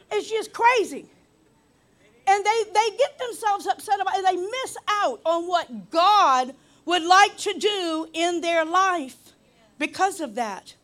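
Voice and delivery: impassioned preacher, big stretched emphatic words; full-force preaching. Real, not acted. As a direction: drive it home to the congregation.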